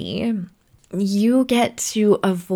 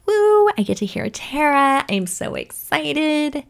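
high, bright put-on fan voice, slowing at the end; mock excited listener; 7 semitones higher than usual. Imitating a listener excited to hear Tara. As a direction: gush like an eager fan who can't wait.

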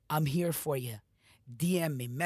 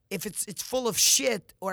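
louder, punching full of shit; forceful rant; 5 semitones higher, 5 dB louder than usual. Real, not acted. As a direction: hammer full hard like a fed-up coach. Motivational rant peak.

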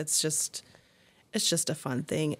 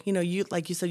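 ordinary delivery

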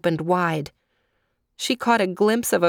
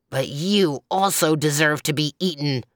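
ordinary delivery, nothing marked

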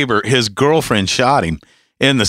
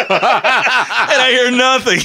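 normal talking voice

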